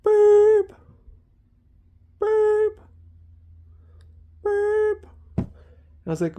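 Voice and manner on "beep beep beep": much higher, spaced-out mock beeps; imitated slow beep. Imitating this drill's alarm, high spaced mock beeps. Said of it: "this one was just like".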